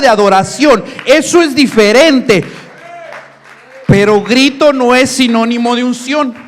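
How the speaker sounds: loud, fervent sermon cry; full-force preaching. Acted. Pastor exhorts the congregation to worship, loud fervent preaching. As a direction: preach it out with fire.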